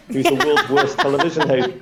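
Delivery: usual speaking voice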